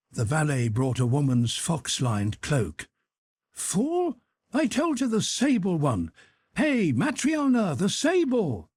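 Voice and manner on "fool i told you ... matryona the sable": angry shout pitched high, ringing through the rooms. Acted. Dolokhov yells at the valet and Matryona, ringing high shout. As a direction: yell it so it carries far through the house. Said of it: "he shouted so that his voice rang".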